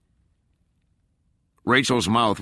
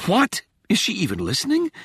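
can't believe she isn't listening; disbelieving; 14 semitones higher than usual. A narrator's reading. Rachel disbelieving.

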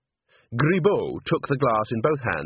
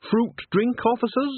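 very high, lilting offer; falsely innocent hostess, pitched right up; coaxing offer; 12 semitones higher than usual. A narrator's reading. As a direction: tempt them in a high, innocent voice. Witch coyly offers doctored drink.

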